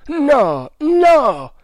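loud, high cry of refusal; vehement exclamation. A narrator's reading. Man refusing to accept the loss, vehement cry. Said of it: "esclamò Tremal Naik".

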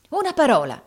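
loud, high furious cry; foaming with rage. A narrator's reading. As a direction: burst out enraged. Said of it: "esclamò Rodolfo con la schiuma alle labbra".

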